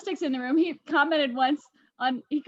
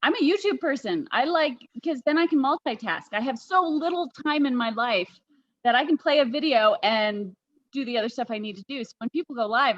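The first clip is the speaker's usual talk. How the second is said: normal talking voice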